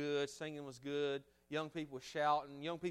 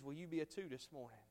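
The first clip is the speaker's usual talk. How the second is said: ordinary talk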